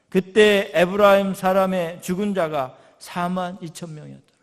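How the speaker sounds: loud, driving sermon delivery; forceful preaching. Acted. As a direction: preach it out to the congregation. Preaching on Judges passage. (힘 있게 외치는 설교)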